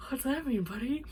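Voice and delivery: high, soft wary question; mock puzzled. Confused guest questions her drunk friends, puzzled.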